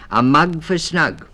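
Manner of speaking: high and loud, then settling; father mouse counting for the children; counting aloud. A narrator's reading. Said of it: "said he".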